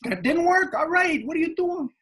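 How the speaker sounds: very high-pitched mock call; happiness calling out. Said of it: "It's like forever calling out".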